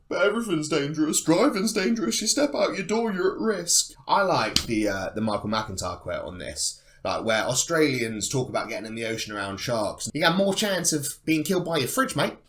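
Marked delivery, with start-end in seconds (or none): 0.1-3.9 high, loud mock whine of an excuse; mock protest. Mimicking those who excuse the risk, high mock protest. 10.1-12.4 higher, louder mock Australian; terrible Australian accent. Quoting Australians about sharks, hammed-up Australian accent. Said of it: "that's a terrible Australian accent".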